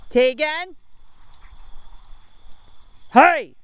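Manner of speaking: sharp loud call to the dog; loud high call across the yard. Real, not acted. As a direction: shout the dog's name to stop her. Calling out to dogs.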